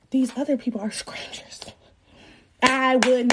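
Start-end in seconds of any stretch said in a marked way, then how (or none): 0.1-1.6 conspiratorial whisper; whispered aside. Retold or imitated. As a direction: whisper it like a secret. Aside about strangers present.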